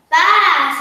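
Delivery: bleating baa; sheep baa. Acted. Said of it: "said the sheep".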